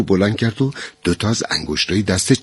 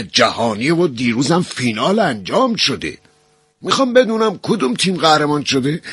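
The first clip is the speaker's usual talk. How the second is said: sudden raised high yell; narrated shout climbing higher; 7 semitones higher than usual. A narrator's reading. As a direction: burst out at him, pitched high. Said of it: "ناصر یهو فریاد زد".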